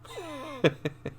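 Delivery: quick high-pitched laugh after a joke; short high laugh. Real, not acted. Gamer laughing at his own jab at Jett mains, short high laugh.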